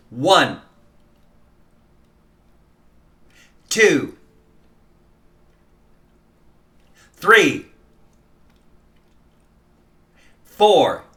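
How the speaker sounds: each downbeat said out loud, alone; only the downbeats. Shown as a demonstration. Teacher calling only the first beat aloud. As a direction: call each beat number with silence between. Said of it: "yelling it in your head".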